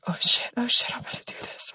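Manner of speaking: breathless whispered psych-up, barely voiced; nervous whisper. Psyching herself up in the tub, nervous whisper. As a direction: whisper it to yourself, nerves jangling. Nervous self-talk before the bath.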